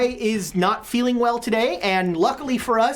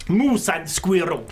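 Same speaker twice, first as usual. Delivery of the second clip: slow mock-Russian cartoon villain accent; hammy, slow Russian-accented catchphrase; 2 semitones lower, slower than usual. Shown as a demonstration. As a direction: draw it out in a fake Russian accent. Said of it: "your trigger word for Russian".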